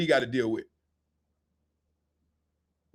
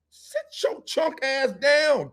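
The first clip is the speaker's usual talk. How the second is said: high, drawn-out scolding order; exasperated command; 7 semitones higher, much slower than usual. Acted. What he thinks at a cursing parent, exasperated order. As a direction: stretch each word in exasperation.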